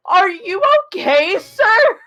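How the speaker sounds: alarmed, rising concern; alarmed question. Acted. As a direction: jolt up in alarm at the sight. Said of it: "I'm like".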